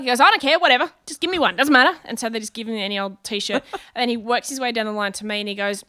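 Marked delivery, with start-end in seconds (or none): 0.2-2.0 loud, higher brush-off; impatient dismissal. Retold or imitated. Man answers tour manager about size, loudly dismissive. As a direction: imitate someone waving the question away.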